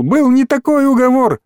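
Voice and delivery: high-pitched frantic protest; nearly squealing. A narrator's reading. Small boy protests broken deal, nearly squealing. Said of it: "Мелкий почти визжал".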